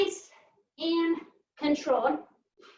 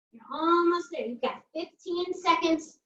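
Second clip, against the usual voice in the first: usual speaking voice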